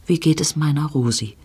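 ordinary delivery, nothing marked

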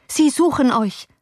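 high-pitched anxious warning; alarmed. A narrator's reading. Servant to his hunted master, alarmed. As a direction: warn him urgently.

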